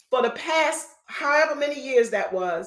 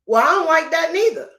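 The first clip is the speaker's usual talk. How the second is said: indignant; 5 semitones higher, 6 dB louder, faster than usual. Real, not acted. Griping about mayonnaise prices.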